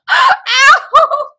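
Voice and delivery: high laughing ow; laughing yelp. Real, not acted. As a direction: squeal as it pops out. Retainer pulled out.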